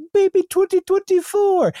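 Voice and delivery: put-on high squeaky baby voice. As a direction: squeak it like a newborn. Baby voice for new year.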